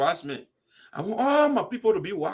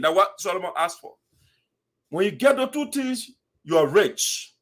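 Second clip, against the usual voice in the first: usual speaking voice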